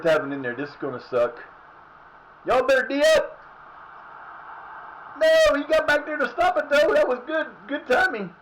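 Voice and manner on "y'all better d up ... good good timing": high, loud excited cheering; excited shouting. Real, not acted. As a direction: cheer the team on.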